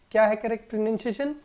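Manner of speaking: normal talking voice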